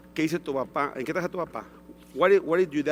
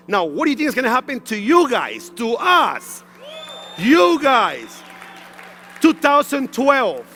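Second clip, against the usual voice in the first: rousing shout; 3 semitones higher than usual. Real, not acted. Speaker rallying the audience about their future, rousing shout. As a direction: pump up the room, shout it out.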